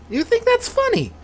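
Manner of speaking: high indignant squawk; outraged old man. Acted.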